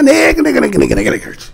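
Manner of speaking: quick mocking imitation of a teacher chanting the slur; imitating the teacher. Mimicking a Wisconsin teacher at her class, quick and mocking. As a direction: rattle it off fast like the teacher. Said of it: "She said it real quick".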